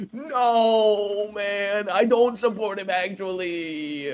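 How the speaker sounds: drawn-out, hammed-up denial; mock protest. Streamer jokingly denying support for him, mock protest. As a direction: stretch the last words into a long mock whine. Acting out his reply, drawn out.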